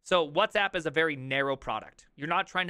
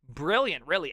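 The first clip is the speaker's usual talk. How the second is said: usual speaking voice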